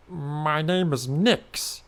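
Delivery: slow, drawn-out creature voice; a lightly voiced creature naming itself; changeling voice. Changeling tells the hero its name, slowly.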